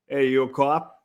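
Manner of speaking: lightly put-on Boston accent; a hint of a Boston tough guy asking. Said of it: "The Boston accent?".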